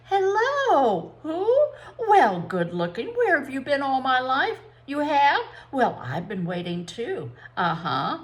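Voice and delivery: sugary, swooping phone flirtation; honeyed voice. A narrator's reading. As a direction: coo sweetly into the receiver. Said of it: "in a honeyed voice".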